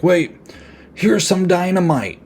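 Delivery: high, mocking girl's voice; lightly voiced eager helper; mock Tracy. Tracy voiced offering dynamite.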